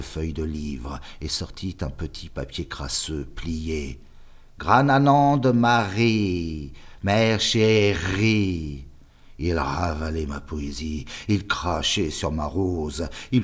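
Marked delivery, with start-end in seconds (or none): 4.6-8.8 higher, louder, very slow mocking drawl of "Grananande"; sneering stammer. The superior reading the boy's poem aloud, sneering. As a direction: stretch every syllable with hateful ridicule. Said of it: "Il bégayait, prolongeait chaque syllabe avec un ricanement de haine".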